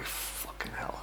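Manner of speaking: breathless whispered swear; whispered curse. Retold or imitated. Lying exposed while everyone stares, whispered curse. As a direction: hiss it under your breath in embarrassment.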